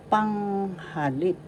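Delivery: "Panghalip" slow, long high PANG, stressed LIP; accent on first and third. Shown as a demonstration. Teacher demonstrating "Panghalip", stressed first and third syllables. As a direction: draw out PANG, then land on LIP in "Panghalip". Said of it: "So the first and the third has the accent".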